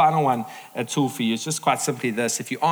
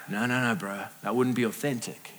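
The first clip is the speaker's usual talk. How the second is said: low, offhand brush-off; dismissive quote; 5 semitones lower, 6 dB quieter than usual. Retold or imitated. Imagined spouse brushing off effort in marriage, dismissive. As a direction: wave it away in a lower voice.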